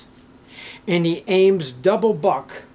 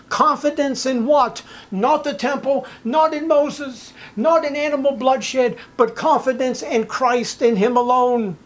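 higher, louder preacher's call; full-force preaching; 8 semitones higher, 3 dB louder than usual. Acted. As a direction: drive the warning home from the pulpit.

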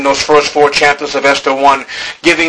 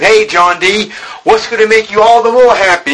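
higher, louder teasing call to John D; mock eager questioner; 9 semitones higher, 3 dB louder than usual. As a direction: needle him like a curious crowd. Voicing people questioning Rockefeller.

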